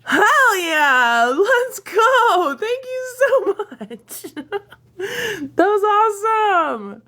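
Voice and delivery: loud, high, thrilled cheer; excited cheering. Real, not acted. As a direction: shout for joy at the gift. Celebrating a big gift.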